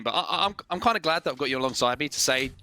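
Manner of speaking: normal talking voice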